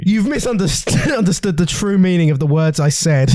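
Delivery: echoing own line through laughter; laughing. Acted. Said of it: "That's not what I did".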